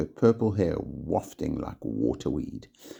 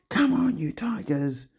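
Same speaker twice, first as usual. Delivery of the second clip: high excited yell; 13 semitones higher than usual. A narrator's reading. Giles urging his canoe mates on, yelling. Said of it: "Giles yelled".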